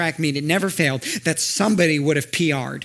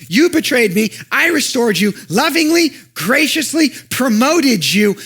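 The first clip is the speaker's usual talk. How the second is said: high, loud urgent call to a wandering friend; Jesus calls Peter back, raised and pleading; 9 semitones higher, 6 dB louder than usual. Acted. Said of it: "Jesus is trying to get Peter's attention".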